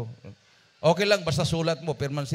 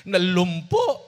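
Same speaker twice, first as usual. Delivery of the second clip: loud, higher anguished cry; distressed cry; 5 semitones higher, 9 dB louder, much slower than usual. Retold or imitated. Maid crying to the pastor, loud anguished wail. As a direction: wail the word in despair.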